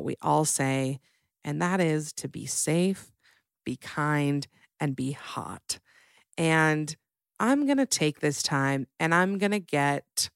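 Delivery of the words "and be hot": breathy whispered tag line; teasing whisper. Acted. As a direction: drop to a sly whisper. Whispered motto ending.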